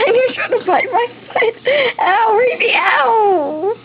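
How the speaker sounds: high, laughing yelps of ow. Real, not acted. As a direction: laugh through playful yelps. Child bites foot, laughing.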